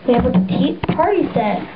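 ordinary delivery

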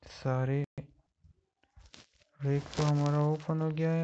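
ordinary talk

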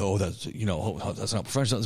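low, dismissive imitation of a naysayer; a hint of a scoffing colleague; mocking critic. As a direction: lightly mimic a sniffy critic. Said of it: "don't let someone tell you that".

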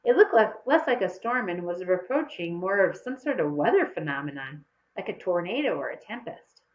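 plain reading voice

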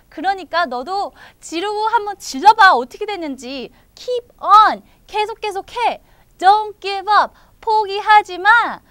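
high, loud pep talk; loud cheering-on. Acted. Cookie tells Rookie not to give up, loud encouragement. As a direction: urge a discouraged friend on at full voice. Friend urges Rookie to keep going.

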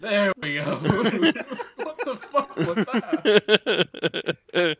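loud, high laughing; laughing out the words. Real, not acted. Laughing fit.

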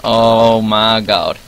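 very slow, drawn-out groan; long, monotone exasperated groan; weary dismay. Real, not acted. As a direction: drag every word out in defeat. Losing, in last place.

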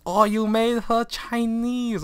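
very high, drawn-out mock Chinese accent; high-pitched Chinese-accented teacher imitation; Chinese accent impersonation. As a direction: exclaim slowly in a hammed-up Chinese accent. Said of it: "my Chinese impersonation".

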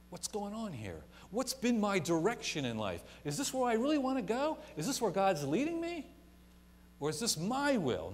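preacher building to a peak; rising preaching. Acted. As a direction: climb with each question. Preaching at full force.

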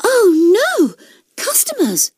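high-pitched dismay; alarmed cry. A narrator's reading. Cassie finds customers lying in a heap, alarmed high cry. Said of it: "she cried".

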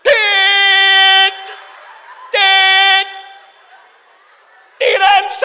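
high, loud blocked yell; stuttered shout. Acted. Stuttering raft owner yelling the warning, high shout. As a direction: shout it, sticking on the first syllable. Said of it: "yo les grito".

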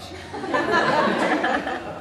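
short breathy laugh at his own joke; laughing at the insane father line. Real, not acted. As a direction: let a real laugh slip out.

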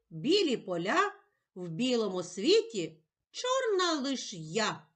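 gentle sing-song poem reading; poem for children. Acted. Teacher to child, reading Первый сніг in a gentle recital voice.

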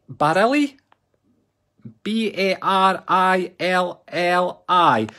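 spelling out slowly. Real, not acted. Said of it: "how do you say that?".

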